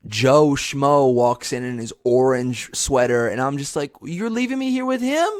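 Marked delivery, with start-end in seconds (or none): 4.0-5.3 high-pitched incredulous protest; mock dismay. Kid left with the replacement host, mock dismayed. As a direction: whine it in hammed-up disbelief.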